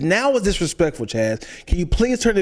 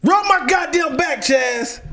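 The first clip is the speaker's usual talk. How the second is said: mock yell; 9 semitones higher, slower than usual. Fed-up wife yelling at partner gaming, mock yell.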